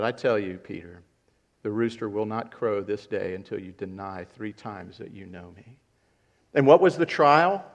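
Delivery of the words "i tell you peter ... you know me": soft, lower, solemn prophecy; quiet foretelling. Acted. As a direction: say it hushed and sorrowful. Jesus foretelling denial.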